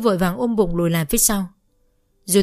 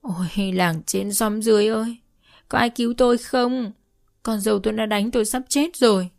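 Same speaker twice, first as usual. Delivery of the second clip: mildly raised wail calling the neighbours; wailing cry; 3 semitones higher than usual. A narrator's reading. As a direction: cry out for help, mildly hammed-up. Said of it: "bà lại gào lên". (gào lên kêu cứu)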